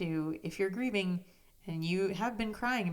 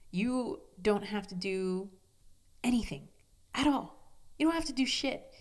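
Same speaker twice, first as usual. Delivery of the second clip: emphatic reassurance; 4 semitones higher than usual. Real, not acted. Insisting to grieving listeners.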